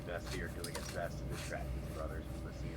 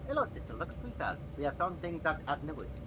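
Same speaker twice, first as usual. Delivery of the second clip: put-on high, bright young man greeting his sister; young brother greeting sister, high and cheerful; cheerful lanky boy; 7 semitones higher than usual. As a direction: show off the find, pitched up. Plays Vestir.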